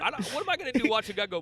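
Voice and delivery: ordinary talk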